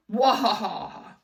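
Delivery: loud villain cackle; evil laugh. A narrator's reading. Principal cackling at the kids. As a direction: cackle in triumph.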